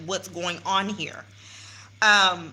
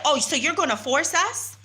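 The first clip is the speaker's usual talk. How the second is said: higher, indignant challenge; mock outrage, pitched up; retold challenge; 7 semitones higher than usual. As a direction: ask it back, incredulous. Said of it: "And so I said".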